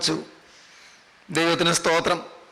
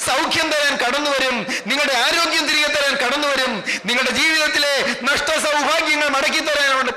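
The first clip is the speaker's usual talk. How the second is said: driving, sustained preacher's chant, building higher; impassioned preaching; 4 semitones higher than usual. Real, not acted. Preacher promising the congregation that God restores what was lost, impassioned.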